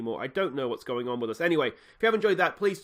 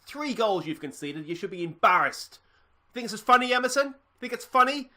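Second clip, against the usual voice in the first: higher, louder scolding of the squad; furious coach rant; 5 semitones higher, 3 dB louder than usual. Acted. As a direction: tear into the players like a livid manager. Manager rants at players post-match.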